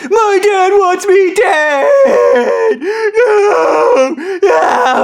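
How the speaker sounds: high, drawn-out mock wail; hysterical wailing. Imitating Stu heard behind the phone call, wailing. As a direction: imitate a panicked man howling in the background. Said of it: "I hear Stu in the background".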